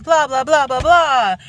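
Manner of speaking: exasperated sing-off of busywork; dismissive blah-blah. Real, not acted. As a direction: wave away the tedious list, loudly. Mocking a list of church meetings.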